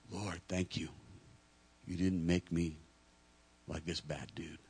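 low, quiet, smug mock prayer; self-righteous man praying. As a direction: murmur thanks for not being like him.

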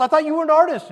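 loud, high-pitched acted disbelief; puzzled surprise. Messenger finding the artist in textiles, surprised. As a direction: play someone thrown by what they have found.

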